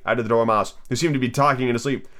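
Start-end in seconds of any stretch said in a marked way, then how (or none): none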